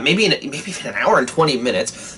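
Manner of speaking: ordinary talk, nothing marked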